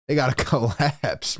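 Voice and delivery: a laugh breaks into collapse; amused, laughing at the collapse. Real, not acted. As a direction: chuckle through the words. Laughing at the team's fate.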